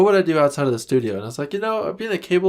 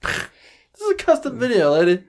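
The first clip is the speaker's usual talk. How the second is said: higher, mock-outraged scolding of a customer; hammed-up indignant rant; 10 semitones higher than usual. Podcaster mockingly scolds the imagined customer, much higher. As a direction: pretend to scold the client, voice up high.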